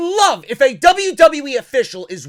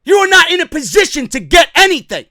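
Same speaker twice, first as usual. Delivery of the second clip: loud, raised, emphatic telling-off; angry rebuke; 5 semitones higher, 5 dB louder than usual. Real, not acted. Fan scolding a wrestling promoter, angry rebuke. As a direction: put the boss firmly in his place, loudly.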